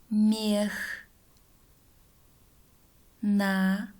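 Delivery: slow word-by-word dictation; drawn out, one word at a time; dictating. Shown as a demonstration.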